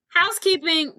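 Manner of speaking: high, called-out housekeeper announcement; imitated housekeeper's call. Housekeeper calling at the door.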